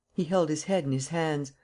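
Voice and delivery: usual speaking voice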